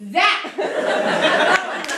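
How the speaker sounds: called out loud to the room; calling out Zach. Real, not acted. Host calls the next comic, loud call. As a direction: shout the name across the club. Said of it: "calling the next comic".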